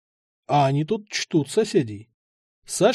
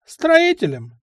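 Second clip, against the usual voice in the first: put-on a higher, curt old man's answer; blunt old man; 13 semitones higher, 5 dB louder, slower than usual. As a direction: snap out the word like the old man. Old man voiced, matter-of-fact.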